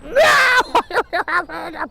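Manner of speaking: very high, loud drawn-out no; terrified scream. Acted. Mouse realises how high up he is, screaming. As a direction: shriek in fright.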